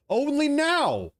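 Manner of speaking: "only now" stretched out, high and drawn; sarcastic slow emphasis on "now"; slow emphatic stress. Real, not acted. Ranting, hammering his key phrase.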